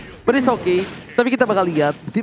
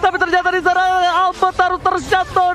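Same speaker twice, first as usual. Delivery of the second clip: fighting game announcer; 10 semitones higher than usual. Real, not acted. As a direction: scream the kill calls like an arena announcer. Hype call of kills. (teriakan komentator penuh semangat)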